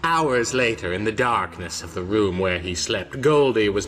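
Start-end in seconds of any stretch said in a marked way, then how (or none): none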